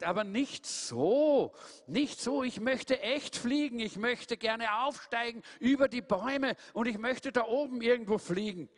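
hoch, langsam und sehnsüchtig; träumerisch vom Fliegen schwärmen; sehnsüchtiger Truthahn. Acted. Tom sehnt sich, hoch. (Tom träumt vom Fliegen, hoch und langsam)

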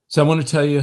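normal talking voice

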